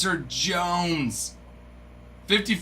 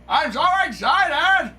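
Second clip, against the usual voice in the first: high, louder, buzzing with joy; so excited; 10 semitones higher, 4 dB louder than usual. Real, not acted. Streamer shouting joy to chat, high and buzzing. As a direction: let the excitement burst out.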